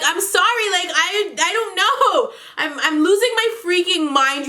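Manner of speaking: high-pitched exasperated pleading, half laughing; hammed-up frazzled excuse; flustered apology. Acts out flustered apology to partner.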